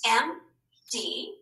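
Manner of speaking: "M D" as two separate beats, a pause between; split into syllables. Shown as a demonstration. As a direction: break "M D" apart syllable by syllable. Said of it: "how do we split up those syllables".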